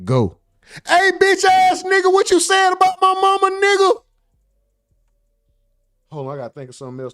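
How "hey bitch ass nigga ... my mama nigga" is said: high, yelling mock insult; mock rant. Streamer yelling at the rapper about his mama, high mock rant. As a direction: bark at the rival like a hothead.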